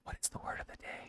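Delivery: hushed, breathy teaser; whisper. Acted. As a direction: whisper it like a secret. Teasing build-up before word of the day.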